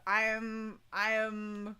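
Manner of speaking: normal talking voice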